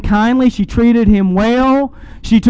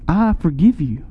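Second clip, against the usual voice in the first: soft, gentle and hushed; tender forgiveness; 5 semitones lower, 4 dB quieter than usual. Acted. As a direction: forgive her gently, close to her face. Husband tenderly forgives returning wife.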